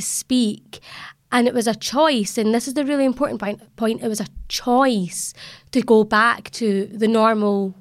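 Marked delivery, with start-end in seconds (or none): none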